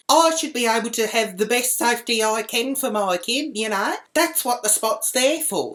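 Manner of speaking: high-pitched mock indignant mum; high, self-righteous complaining; going on and on. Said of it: "then she starts going on".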